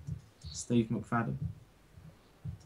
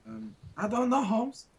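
mock Mexican accent, higher; 9 semitones higher than usual. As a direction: push a bad Mexican accent. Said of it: "That was the worst Mexican accent ever".

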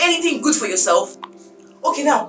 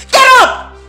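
loud, high-pitched order; angry shout; 10 semitones higher, 5 dB louder than usual. Acted. Employer ordering drunk maid up, angry shout. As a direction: shout it as a furious command.

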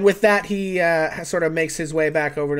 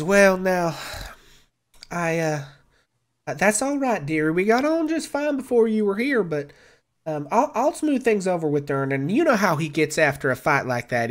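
kindly barmaid Bonnie. Acted. Bonnie reassures Mel about smoothing things over with Durnan, kindly. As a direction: soothe them in a homespun way. Game master voices Bonnie.

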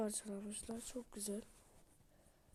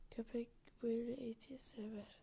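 whisper; 8 dB quieter than usual. Real, not acted. Quiet aside about the dog.

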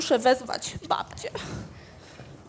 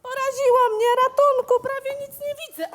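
higher, louder panicked cry; frightened cry; 10 semitones higher, 6 dB louder, much faster than usual. Acted. Boy shocked, cries for help.

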